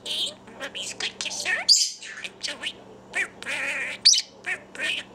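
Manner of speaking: ordinary talk, nothing marked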